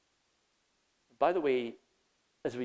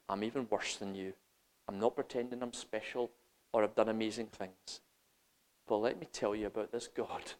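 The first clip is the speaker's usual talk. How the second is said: softer, confessional; intimate appeal; 6 semitones lower, 8 dB quieter than usual. Acted. Broken believer telling a friend about God, soft and humble. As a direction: say it humbly, close.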